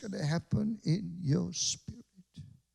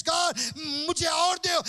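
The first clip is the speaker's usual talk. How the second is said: a hint of a child begging for more; crying out for more; mock thirsty plea; 11 semitones higher, 5 dB louder, much faster than usual. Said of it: "All they're saying is".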